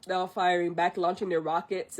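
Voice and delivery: normal talking voice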